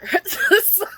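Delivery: sudden high laugh; high burst of laughter. Real, not acted. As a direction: laugh it off, amused. Laugh after mom remark.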